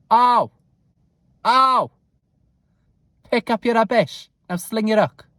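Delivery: loud, high impression with blunt exclamations; Nessa. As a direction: call out a loud, bossy telling-off. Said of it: "That's my greatest Nessa impression".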